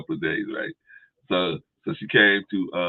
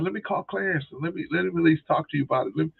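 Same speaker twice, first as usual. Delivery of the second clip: higher, lightly mocking voice; wife warning her sister, higher mock voice; imitating wife; 9 semitones higher than usual. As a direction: raise the pitch to play the worried wife. Wife offers help.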